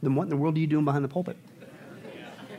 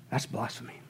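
hushed verdict; 6 semitones lower, 12 dB quieter than usual. Retold or imitated. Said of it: "And James would say".

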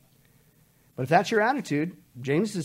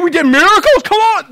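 indignant boast; 11 semitones higher, 14 dB louder than usual. Acted. False believers boast.

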